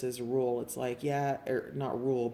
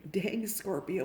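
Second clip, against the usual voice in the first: ordinary talk, nothing marked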